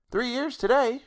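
high-pitched country woman; landlord's wife. A narrator's reading. Wife confirming the date to her husband, high-pitched. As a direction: answer in a high rustic voice.

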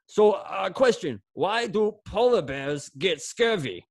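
high, slow Russian coach voice; Coach Kirilov Russian accent. Retold or imitated. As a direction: deliver the joke like the Russian coach. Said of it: "your Russian accent".

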